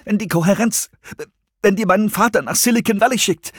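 high, anxious and halting; agitated, as if trembling. A narrator's reading. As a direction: stumble into the fear, pitched high. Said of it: "mit bebender Stimme".

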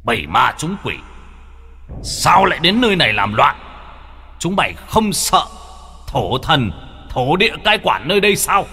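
high, ringing commanding voice; booming like thunder. A narrator's reading. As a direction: thunder it out like a warrior sorcerer. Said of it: "giọng nghe vang như sấm".

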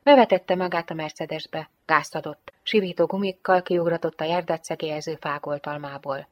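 usual speaking voice

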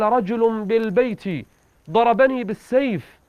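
high-pitched, drawn-out complaint; storyteller voicing a wounded man's cry for help; acted crying out. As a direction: cry out that you've been attacked, pitched high. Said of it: "ما لك تصيح".

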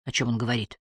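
hushed, low worried question; a touch of worry, softly; anxious question. A narrator's reading. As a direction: ask it uneasily, keeping the voice down. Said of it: "тревожно спросила Эмили".

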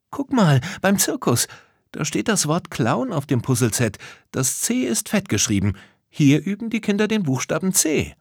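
high, keen character voice; Lisa, eager discovery. Acted. Lisa shows her friends the puzzle clue, high and eager. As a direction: point out a find in a high voice.

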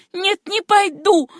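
high, loud, slow refusal; refusing. Acted. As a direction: dig in and refuse. Susanna refuses her uncle. (высокий громкий отказ)

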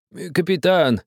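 put-on high, pleading old peasant; old man's voice. A narrator's reading. Old man calling to the captain, high and pleading. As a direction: call up to the captain like an old villager. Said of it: "говорил старик".

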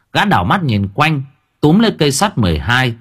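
ordinary delivery, nothing marked

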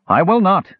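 half-elf shouting at the door in fury; furious shout. Acted. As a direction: shout it back with sudden fury. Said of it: "shouted with a fury".

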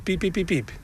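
high quick peeps in a row; Piep, piep, piep, piep chirped like a bird; imitating pipit song. Shown as a demonstration. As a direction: peep like a motor starting up. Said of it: "een motortje wat moet opstarten".

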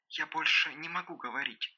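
very soft, hushed voice; near whisper. A narrator's reading. Девушка боится продолжать разговор, near whisper. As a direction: say it almost in a whisper. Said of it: "сказала девушка почти шёпотом".

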